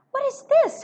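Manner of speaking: Lorry cries out, breathless and confused; breathless alarmed cry. A narrator's reading. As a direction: gasp it out, startled and confused. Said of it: "cried Mister Lorry, breathless and confused".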